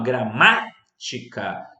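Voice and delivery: gramática slowed, MÁ punched loud; stress on antepenultimate syllable. Shown as a demonstration. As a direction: hit the MÁ in gramática hard. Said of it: "sílaba tônica na antepenúltima sílaba". (gramática silabado, com força no MÁ)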